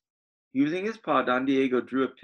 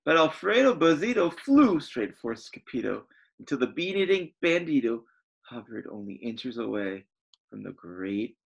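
ordinary delivery, nothing marked

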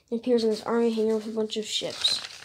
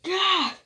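short high yelp; mock "ah!" as if struck; high comic-book yell; acted cry of pain; 8 semitones higher, 3 dB louder than usual. Hero gets hit.

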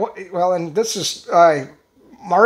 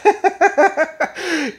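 amused laugh; 7 semitones higher than usual. Real, not acted. Laughing at Russia going along.

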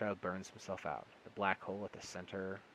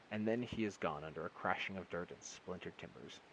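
usual speaking voice

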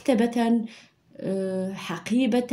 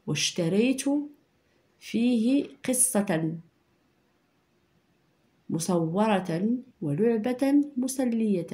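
very slow dictation with long pauses; much slower than usual. Shown as a demonstration. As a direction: dictate it phrase by phrase, slowly. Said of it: "إذا لاحظوا معايا هنا".

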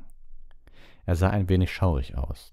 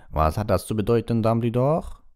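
raised pitch, louder, demanding; imperious; 6 semitones higher, 5 dB louder than usual. A narrator's reading. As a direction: demand an answer with authority. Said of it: "sagte sie in gebieterischem Ton". (gebieterisch, laut und fordernd)

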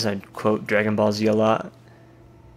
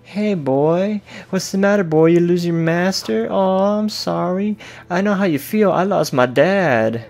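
tender dog talk; 6 semitones higher than usual. Pretend. Player comforts lost dog met in wasteland, high cooing. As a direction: coo to the stray like a kind owner.

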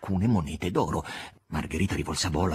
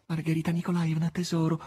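pleading; 8 semitones higher than usual. A narrator's reading. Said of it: "in tono supplichevole".

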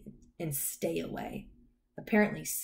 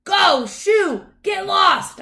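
loud, high-pitched angry shout; exasperated full shout; 14 semitones higher, 12 dB louder, much slower than usual. A narrator's reading. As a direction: yell to chase the dog off. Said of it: "I shouted".

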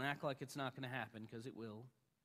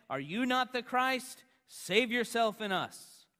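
raised, higher voice, railing in a preacher's retelling; railing criminal; 7 semitones higher, 8 dB louder than usual. A narrator's reading. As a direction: preach the criminal's jeer loud and high. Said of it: "railed at him".

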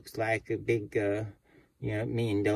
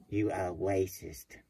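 normal talking voice

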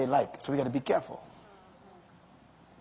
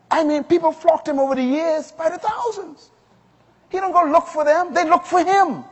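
high-pitched, driving preacher delivery; raised, emphatic sermon voice; preaching; 11 semitones higher, 6 dB louder than usual. Real, not acted.

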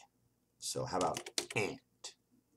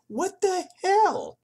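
high, loud, drawn-out outburst; exasperated yell; 12 dB louder, much slower than usual. Real, not acted. Player thwarted taking the baby bottle, exasperated yell. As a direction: shriek in disbelief at the game. Exasperated as item disappears again.

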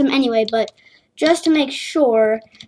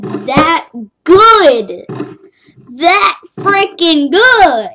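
slow, louder mock boast; drawn-out sarcasm; 4 semitones higher, 7 dB louder, much slower than usual. Real, not acted. Gamer mocking his own bad play, slow loud sarcasm. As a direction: stretch it out with sarcasm.

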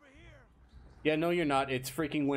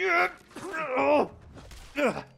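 startled yelp; 5 dB louder than usual. Real, not acted. Startled in fight.

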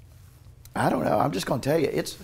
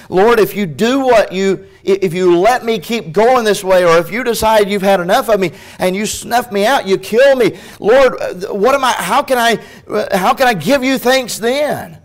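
high, loud, urgent pulpit pleading; impassioned preaching plea; 8 semitones higher, 8 dB louder than usual. Acted. Preacher voicing David pleading with the Lord for his life, impassioned. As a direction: preach it as a desperate appeal to God.

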